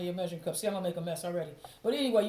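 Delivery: ordinary delivery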